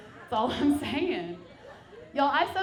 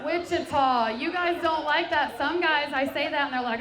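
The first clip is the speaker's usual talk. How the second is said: higher, louder, teasing the crowd; 5 semitones higher, 6 dB louder than usual. Real, not acted. To a groaning Wichita crowd, a loud teasing jab. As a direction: needle the audience for groaning.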